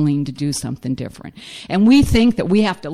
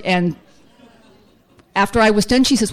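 normal talking voice

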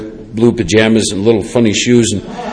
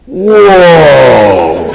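long, drawn-out falling whoa of awe; stoner-like amazement; 4 dB louder than usual. Retold or imitated. Newly sober man hearing a bird from the bus, awestruck. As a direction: stretch it out, utterly mind-blown. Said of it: "were pure [name] and [name] stuff".

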